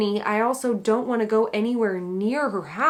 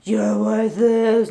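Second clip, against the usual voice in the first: slow, gravelly menacing whisper-growl; a doll's dark voice in her head, dragged out slowly; creepy gravelly taunt; gravelly demon voice; 3 dB louder, much slower than usual. Retold or imitated. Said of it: "it was like this gravelly".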